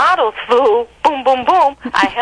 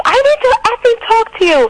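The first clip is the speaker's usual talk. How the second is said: angry yell; 13 semitones higher, faster than usual. Retold or imitated. Girlfriend chasing her uptown, furious. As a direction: shout it after her as she walks off.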